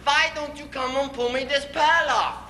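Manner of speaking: high-pitched comic exasperation; mad. Acted. Husband scolding laughing wife to pull pail off, mad. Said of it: "Till I get so mad".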